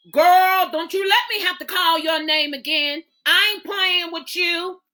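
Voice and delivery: a hint of a sharp high scold; fussing at a dawdling child; lightly voiced mother fussing. Mother scolding late daughter.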